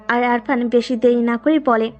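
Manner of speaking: plain reading voice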